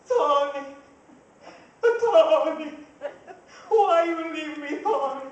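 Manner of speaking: hammed-up grief over the casket; wailing widow. Widow wailing over her husband's casket, hammed-up loud grief.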